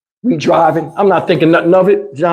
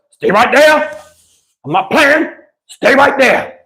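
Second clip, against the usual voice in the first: raised, firm mock order to a goat; imitating the stepdad; 6 semitones higher, slower than usual. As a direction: act out a clumsy man cornering a goat.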